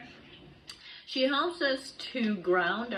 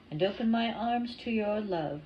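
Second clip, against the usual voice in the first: normal talking voice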